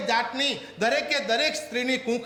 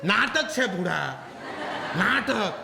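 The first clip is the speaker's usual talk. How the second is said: slow, chuckling rebuke; laughing scold; slower than usual. Acted. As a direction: laugh while scolding the boy. Sister scolds boy, laughing.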